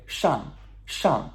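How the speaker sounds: each "shang" starting high and dropping; descending fourth tone. Shown as a demonstration. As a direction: let each "shang" fall steeply. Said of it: "descending tone".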